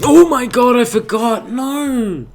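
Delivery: panicked scream. Real, not acted. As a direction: shriek in sudden panic at a mistake. Panic at a forgotten button.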